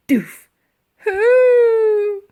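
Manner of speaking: high, loud, strained mammoth hoot; a big beast grunting as it falls; mammoth groan. A narrator's reading. Mammoth slipping again.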